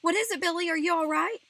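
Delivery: high, loud, alarmed question; frightened shout. A narrator's reading. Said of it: "In a frightened voice".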